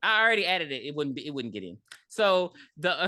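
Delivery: ordinary delivery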